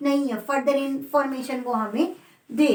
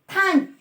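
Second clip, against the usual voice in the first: "Thank" punched higher and louder; stress on thank; 6 semitones higher, 4 dB louder, slower than usual. Shown as a demonstration. Teacher showing students the stressed "thank", higher and louder. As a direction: hit "thank" hard. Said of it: "stress से thank पे".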